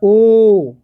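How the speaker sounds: ओ said out clearly with rounded lips; vowel demo. Shown as a demonstration. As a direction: round the lips and give a full ओ. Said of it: "फिर हुआ".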